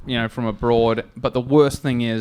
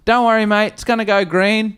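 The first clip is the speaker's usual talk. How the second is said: reassuring mate; 6 semitones higher than usual. Retold or imitated. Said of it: "And Dave says to him".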